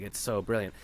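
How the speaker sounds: ordinary delivery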